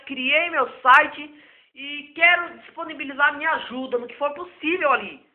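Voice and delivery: ordinary delivery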